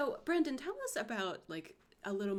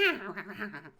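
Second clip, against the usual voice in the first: imitated reluctant creature yell; displeased imp attack cry; 4 dB louder than usual. Host mimicking the imp attacking Michael, displeased cry. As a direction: grunt out a disgruntled imp noise. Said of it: "He's just like".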